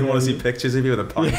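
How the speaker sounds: laughing. Real, not acted. Still laughing about stag pictures.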